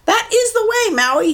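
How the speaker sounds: a bird encouraging her captor; mud hen. Acted. Mud hen guiding Maui, high bird voice. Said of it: "she said".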